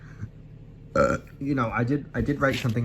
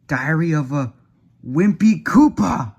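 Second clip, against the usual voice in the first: slow, big, higher announcement of the title; dramatic title reveal; 4 semitones higher, 7 dB louder, slower than usual. Acted. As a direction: proclaim it like a grand title.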